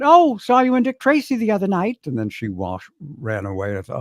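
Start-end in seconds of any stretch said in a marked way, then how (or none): 0.0-2.0 high-pitched mock woman's voice; gushing woman. Woman at an audition who spotted him in a movie, high and gushing. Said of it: "and some woman, uh, said".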